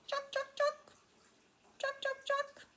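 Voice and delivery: very high, quick triple clucks; red-eyed tree frog call. Shown as a demonstration. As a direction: imitate a little tree frog in short high bursts. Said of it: "red-eyed tree frog, and he goes".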